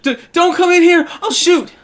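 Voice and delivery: high, loud stammered threat; cornered halfling's high-pitched yell; frightened halfling. Acted. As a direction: shout a panicky warning in a high small voice. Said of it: "he says, he says".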